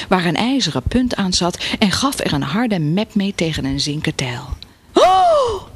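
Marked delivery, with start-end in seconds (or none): 4.9-5.6 very high startled squeal; a short, high "oh" of fright; little shriek. A narrator's reading. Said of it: "Met een gilletje". (geschrokken gilletje)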